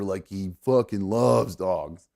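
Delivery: usual speaking voice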